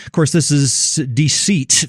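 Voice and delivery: ordinary talk, nothing marked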